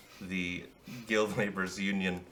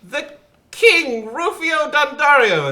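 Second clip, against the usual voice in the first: much higher and louder royal fanfare call; announcing the king; 12 dB louder, slower than usual. Acted. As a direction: herald the king's grand entrance. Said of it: "And finally he goes".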